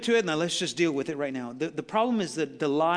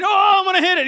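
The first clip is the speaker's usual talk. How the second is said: much higher, louder, hammed-up yelp; mock alarm on a ride; 15 dB louder than usual. Pastor reliving a flight simulator ride, hammed-up yelp. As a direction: play a rider about to crash.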